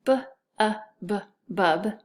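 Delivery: "B, uh, b" as separate sounds, then "bub"; tapping out sounds. Shown as a demonstration. Teacher tapping out the first syllable, sound by sound. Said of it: "let's tap out the first syllable".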